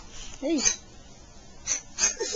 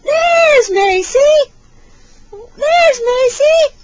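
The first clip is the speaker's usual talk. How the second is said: high, loud, stretched-out squeal; slow, high, excited singsong; peekaboo reveal; 11 semitones higher, 9 dB louder, slower than usual. Pretend. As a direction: burst out in delight for a baby.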